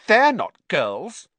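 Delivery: slow, high and pointed accusation; suspicious. A narrator's reading. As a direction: call out the intruders, loud and drawn out. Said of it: "eyeing Ron and Harry suspiciously".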